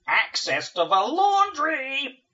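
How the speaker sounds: slow, loud, high buzzing command; buzzing demon voice. Acted. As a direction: drone the order like a swarm queen. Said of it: "buzzes the summoning grid's demonic inmate".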